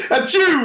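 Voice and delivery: high-pitched mock "achoo"; fake sneeze. Mid hippie act, joking fake sneeze. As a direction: throw in a badly faked sneeze. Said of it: "that's fake".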